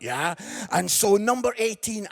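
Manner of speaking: ordinary talk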